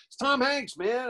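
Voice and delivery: high-pitched retold insistence; mock exclamation. Caller corrects the confused friend on the phone, high insistent imitation.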